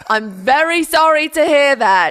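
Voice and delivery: loud, high mock condolence; hammed-up forced politeness; forced sympathy. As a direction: imitate a dutiful, insincere apology. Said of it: "you say it in this forced way".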